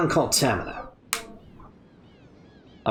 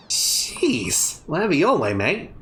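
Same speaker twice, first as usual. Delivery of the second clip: put-on Australian accent, miffed brush-off; huffy Aussie shrug; Outback Steakhouse accent; slower than usual. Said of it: "your Outback Steakhouse accent".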